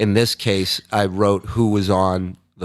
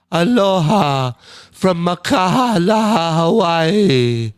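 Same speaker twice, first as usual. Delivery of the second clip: higher, slow, stretched-out aloha; hammed-up Hawaiian greeting; 7 semitones higher, 3 dB louder, much slower than usual. Reading a fan's greeting on stream, high and slow. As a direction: sing it out like a lazy island welcome.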